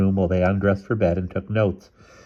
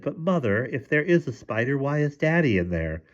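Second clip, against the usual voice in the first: put-on child questioning; 7 semitones higher, 3 dB quieter than usual. A narrator's reading. Child reasons with frightened mother.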